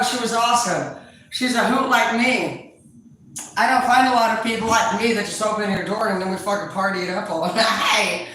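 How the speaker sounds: ordinary delivery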